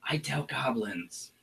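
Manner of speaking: a sly shaman sharing a secret grudge; goblin shaman whisper. Acted. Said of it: "And she says".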